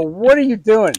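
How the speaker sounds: baffled by the mess; loud baffled question to his wife; incredulous questioning. Retold or imitated.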